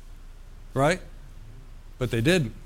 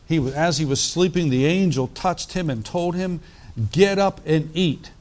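reading the Bible aloud to the congregation, a touch louder; scripture reading; 2 semitones higher, 3 dB louder than usual. Acted. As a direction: lift the passage from the page like a preacher. Preacher reads scripture aloud.

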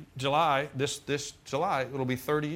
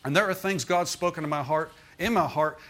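usual speaking voice

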